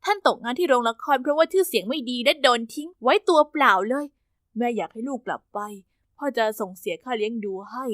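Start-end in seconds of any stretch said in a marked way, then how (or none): 4.6-7.9 quiet father. Acted. Father quietly telling his daughter her mother wants her back, low and soft. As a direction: lower and much softer, a father's hushed report.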